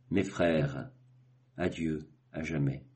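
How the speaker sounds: Détemps quietly bidding his brothers farewell; almost under breath. A narrator's reading. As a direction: let the goodbye sink to a near hush. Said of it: "presque à voix basse".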